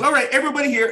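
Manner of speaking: high, loud call to the crew; rally the set like a director. Retold or imitated. Tarantino calling the crew to places, loud and high.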